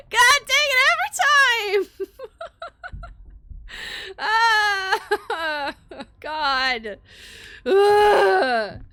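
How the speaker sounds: high shaky laugh after a fright; scared laughter. Real, not acted. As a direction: laugh it off, still rattled.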